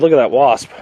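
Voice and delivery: ordinary talk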